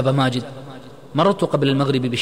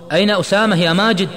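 loud, high, demanding; insistent repeated question; 8 semitones higher, 7 dB louder than usual. A narrator's reading. Friend asking unanswered question of Majid, insistent and loud. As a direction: press for an answer with raised voice. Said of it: "كرر عماد سؤاله مرة أخرى".

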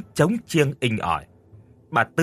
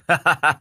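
loud hearty neighbour's laugh; 5 semitones higher, 3 dB louder than usual. Acted. Neighbour greeting the boy with a big laugh. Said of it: "cười lớn lắm".

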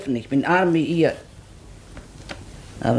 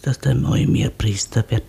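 ordinary talk, nothing marked

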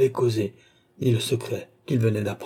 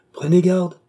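desperate cry; 7 semitones higher, 6 dB louder than usual. A narrator's reading. Philippe cries out to the leaving doctor in despair. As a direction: cry out high and loud. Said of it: "s'écria Philippe au désespoir".

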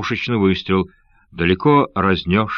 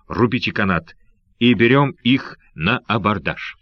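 ordinary delivery, nothing marked

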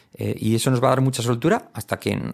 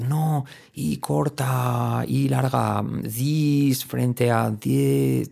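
slow, drawn-out objection of a pedant; fussy purist; 3 semitones higher, much slower than usual. Acted. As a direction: stretch the vowels like a pronunciation purist. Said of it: "un purista siempre va a decir".

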